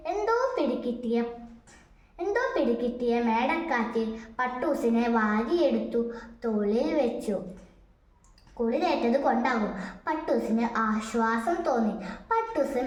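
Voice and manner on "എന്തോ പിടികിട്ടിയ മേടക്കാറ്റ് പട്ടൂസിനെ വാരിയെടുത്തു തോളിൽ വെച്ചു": ordinary delivery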